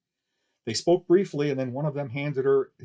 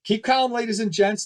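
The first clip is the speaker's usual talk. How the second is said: much higher, louder call for calm; shouted into a megaphone; 8 semitones higher, 6 dB louder than usual. Acted. Barker calming fleeing crowd through megaphone, shout. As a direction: shout over the panic. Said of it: "shouted into the megaphone".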